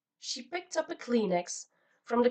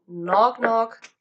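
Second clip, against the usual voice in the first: loud knock knock; 10 dB louder than usual. A narrator's reading. Narrator starts the joke loudly.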